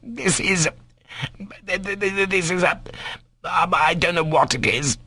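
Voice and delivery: high-pitched, halting stammer; spluttering doctor. A narrator's reading. Doctor leaves amazed and cursing, spluttering. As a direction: splutter in baffled outrage. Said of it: "repeating aloud".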